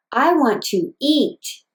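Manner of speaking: eat stretched and emphasized, whole phrase slow; stress on eat. Shown as a demonstration. Therapist showing emphasis to a parent, stress on eat. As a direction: lean into eat with exaggerated inflection. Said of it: "if I wanted to emphasize the word eat".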